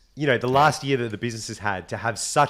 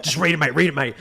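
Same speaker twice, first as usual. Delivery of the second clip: louder, impatient retold prodding; insistent urging; 4 semitones higher, 5 dB louder, faster than usual. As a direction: imitate a mate pushing you to hurry. Retold mate urging him on.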